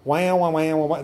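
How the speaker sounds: put-on sarcastic baby crying wah wah; preacher mocking complainers with fake crying. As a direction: mimic a crybaby's wailing. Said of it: "That's grumbling and complaining".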